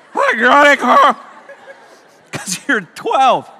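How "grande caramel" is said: mock customer order, laughing and slow. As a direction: order it the way they do, slowly. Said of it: "that's how y'all order it".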